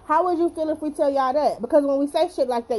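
ordinary talk, nothing marked

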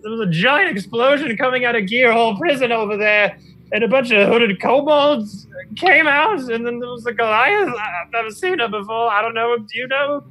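high-pitched, apologetic gnome voice; flustered little gnome. Acted. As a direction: explain haltingly in a high gnome voice.

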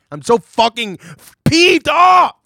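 peeved off shouted high and loud; angry shout. Real, not acted. Cohost yelling about beer reviews, angry shout. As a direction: yell it out, really fed up. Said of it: "I'm just fucking angry".

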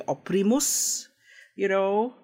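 Oprimus in an Italian accent, oh-pree-moos. Shown as a demonstration. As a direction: Oprimus with Italian vowels, rising. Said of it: "in an Italian accent".

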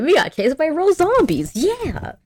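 high-pitched gleeful mimicry; imitated delight. Daphne still loving being chased, mock glee. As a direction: end on a high, hammed-up cheer. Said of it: "she's still loving it".

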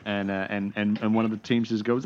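usual speaking voice

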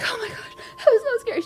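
high-pitched mock distress; imitating hysterical child. Mom quotes her hysterical daughter in the bathroom, mock hysterical. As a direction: squeal it like a badly frightened kid. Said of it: "She's hysterical".

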